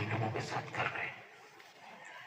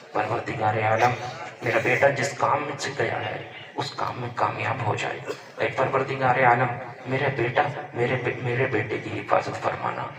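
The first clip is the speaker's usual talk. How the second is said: louder impassioned plea of a mother; mother's loud prayer; 2 semitones higher, 7 dB louder than usual. Retold or imitated. Mother's prayer voiced in sermon.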